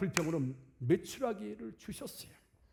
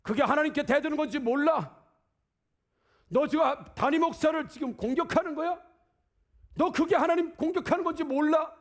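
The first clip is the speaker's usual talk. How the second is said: raised, louder indignant scolding; angry rebuke; 7 semitones higher, 4 dB louder than usual. Acted. Pastor rebuking a member who attacks him, loud and indignant. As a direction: scold them like an offended pastor.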